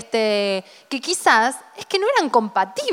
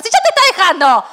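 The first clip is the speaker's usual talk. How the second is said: louder, higher exclaim; mock exclamation; 5 semitones higher, 4 dB louder than usual. Friend mocks the fear of being left, louder and higher. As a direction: scoff it out loud, higher.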